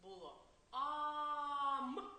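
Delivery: Було ам held very slow and loud; drawn-out syllable. Shown as a demonstration. Teacher showing children how letters join, slow and drawn out. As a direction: stretch ам out so the children hear it. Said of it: "дивіться".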